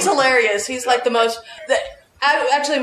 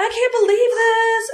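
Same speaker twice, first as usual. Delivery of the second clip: high, slow, whining mock complaint; whiny complaint; 11 semitones higher, slower than usual. Member phoning Al to complain about someone, high whining mockery. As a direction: imitate a member whining down the phone, pitched way up.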